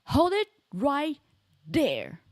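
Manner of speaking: loud, slow, emphatic halt; courtroom interjection. Acted. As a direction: cut the witness off with force. Acts courtroom interruption in game.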